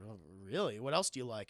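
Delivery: low, teasing character voice; put-on low voice. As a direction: drop the pitch for a character. Low put-on voice in retold exchange.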